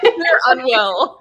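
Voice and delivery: loud, slow, drawn-out high echo. Real, not acted. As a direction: stretch the joke out loud and high. Echoing friend's joke loudly.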